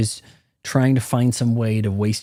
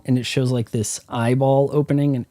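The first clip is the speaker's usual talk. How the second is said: ordinary delivery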